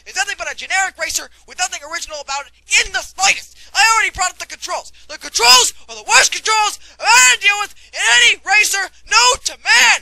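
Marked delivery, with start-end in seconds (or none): 5.1-10.0 furious shout. Acted. Furious rant about the controls.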